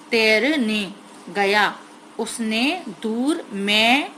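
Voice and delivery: तैर ने गया read slowly, syllable by syllable. Shown as a demonstration. Teacher to young pupils, reading slowly syllable by syllable. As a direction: sound out each syllable slowly for the class. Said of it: "मापे की मात्रा है".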